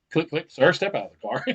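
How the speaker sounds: loud mock police command; stern police order. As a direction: order the driver out like a cop. Acted officer ordering driver out.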